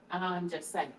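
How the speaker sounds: ordinary talk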